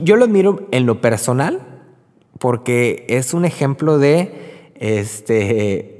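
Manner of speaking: usual speaking voice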